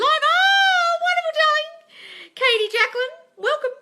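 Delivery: theatrical high greeting. Acted. Greeting a live viewer, theatrical.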